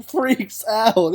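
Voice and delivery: ordinary delivery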